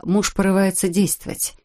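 ordinary delivery

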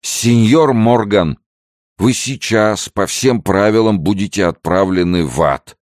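put-on loud, high drunken proclamation; proclaiming. A narrator's reading. Pedro proclaims sentence on Henry, rifle in unsteady hand, proclaiming. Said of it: "провозгласил".

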